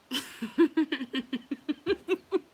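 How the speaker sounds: high, loud cackling laugh; long, real belly laugh up high; loud laughter. Real, not acted. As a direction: crack up completely. Laughing at children's remark.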